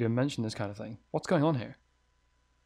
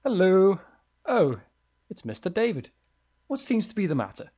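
higher, louder chirpy landlady; sweet higher landlady voice; 6 semitones higher, 6 dB louder than usual. Acted. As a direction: greet the tenant as a cheerful old landlady.

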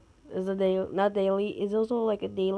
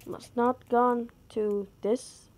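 slow, spelled-out instruction; patient slow explanation; slow teaching; much slower than usual. Shown as a demonstration. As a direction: spell it out slowly for a viewer. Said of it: "I will teach you again".

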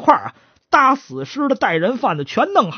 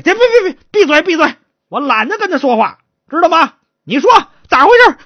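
high, loud silencing; exasperated magistrate; 10 semitones higher, 6 dB louder than usual. Acted. Magistrate telling the stutterer to shut up, angry. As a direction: shut him up, then demand answers.

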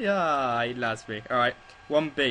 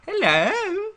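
high squeaky greeting and laugh; high excited hello; 6 dB louder than usual. Real, not acted. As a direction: call out hello playfully. Greets someone in game.

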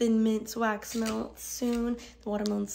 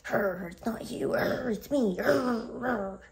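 growled "grr" between words; playful angry growl; 3 semitones higher, slower than usual. Pretend. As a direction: let mock anger out as growls. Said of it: "I'm just angry at the world".

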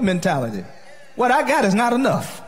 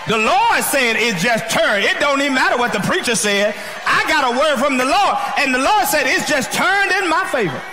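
preaching at full force; 5 semitones higher than usual. Acted. Preacher rising again.

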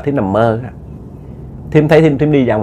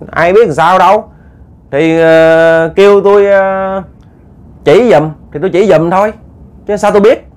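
raised voice as Bói; 6 semitones higher, 3 dB louder than usual. Acted. Bói answers the teachers casually.

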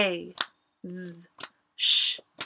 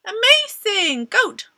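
excited praise; 10 semitones higher, 7 dB louder, slower than usual. Acted.

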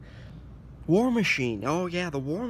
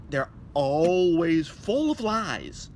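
slow emphatic; 5 dB louder, much slower than usual. Acted.

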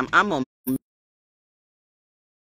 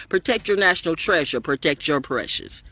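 usual speaking voice

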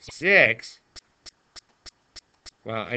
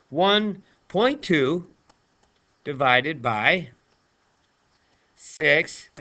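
slow deliberate; much slower than usual. Shown as a demonstration. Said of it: "teacher spells out problem slowly".

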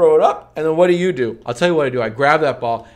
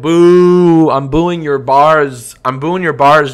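drawn-out loud jeer at the rhyme; loud booing; 2 semitones higher, 9 dB louder, slower than usual. Real, not acted. Mocking his partner's rap with loud boos. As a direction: boo him off the stage.

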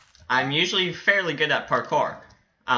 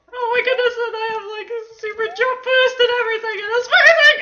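very high, loud gushing; excited squeal; 7 dB louder, faster than usual. Real, not acted. Gamer thrilled by a super jump boost, excited squeal. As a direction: squeal it, building to a loud peak.

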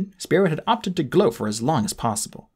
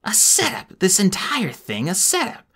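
shocked outrage; 9 semitones higher, slower than usual. Acted. Spiro realizing trap.

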